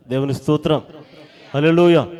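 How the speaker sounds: loud, high hallelujah cry; shouted praise. Real, not acted. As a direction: lift the crowd with a shout of praise.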